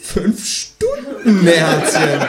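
hugely drawn-out, high, teasing kangaroo; mocking kangaroo. A narrator's reading. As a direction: stretch the hours out to mock the caller. Kangaroo teases caller.

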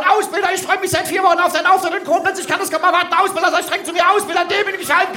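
fast, very high eager cry; high-pitched, breakneck pleading call; loud calling out. Shown as a demonstration. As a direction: shout it like a desperate fan of the drill instructor. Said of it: "rufst ganz laut".

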